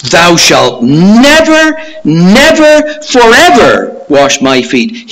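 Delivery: emphatic, high and loud refusal; adamant, with "never" drawn out and pushed up; determined Peter. Acted. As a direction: refuse flat out, hammering each "never". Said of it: "He was pretty determined".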